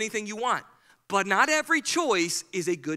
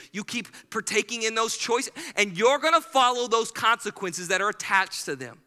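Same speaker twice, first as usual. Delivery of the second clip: ordinary talk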